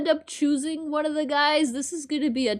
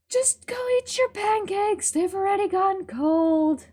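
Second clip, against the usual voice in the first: mom voice; 7 semitones higher than usual. Acted. Voicing the mom character.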